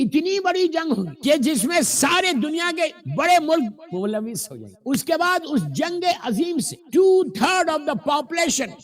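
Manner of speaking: high, forceful sermon delivery; preaching. Acted. Preaches at raised pitch. (پرجوش خطیبانہ انداز)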